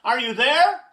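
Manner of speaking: high, loud rising call; anxious call across a field. A narrator's reading. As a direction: shout out searching for someone. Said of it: "and he called".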